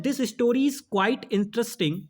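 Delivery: slow, careful reading of the sentence; slow dictation. Shown as a demonstration. Teacher reading the exam sentence aloud, slow. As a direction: read each word slowly for the class. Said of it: "कह रहा है".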